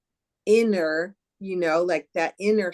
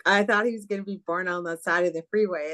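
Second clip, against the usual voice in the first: normal talking voice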